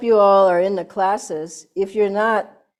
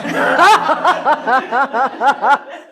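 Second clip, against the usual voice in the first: warm laugh; 5 semitones higher, 8 dB louder than usual. Real, not acted. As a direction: laugh openly, loud and high. Fond laugh at familiar stories.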